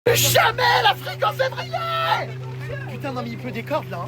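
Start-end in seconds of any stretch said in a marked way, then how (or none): 0.0-2.3 high, slow, drawn-out yell; shouting. Real, not acted. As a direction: howl it at the rain. Yelling in pouring rain. (hurlement aigu et traînant)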